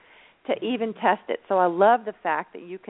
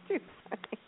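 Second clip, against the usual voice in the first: helpless, breathy little laugh; giggling; 15 dB quieter, faster than usual. Real, not acted. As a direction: barely get it out through giggles. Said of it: "You stop giggling at me".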